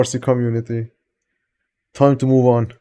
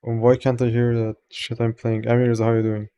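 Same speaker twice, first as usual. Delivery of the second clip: ordinary delivery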